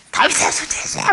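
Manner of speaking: high, strained cry for attention; imitated blind beggar crying out at full stretch; loud desperate cry. Shown as a demonstration. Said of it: "크게 했겠죠".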